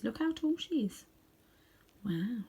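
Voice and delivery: plain reading voice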